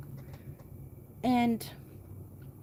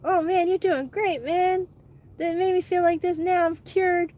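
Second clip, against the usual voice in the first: high, loud mock gushing; overjoyed fake patient, hammed-up; sarcastic patient; 7 semitones higher, 6 dB louder than usual. Mock happy therapy patient.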